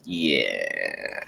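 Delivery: low, soft, drawn-out musing; reenacted quiet second thought; retold pondering. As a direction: let it sink low and quiet, thinking it over. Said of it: "I was just like".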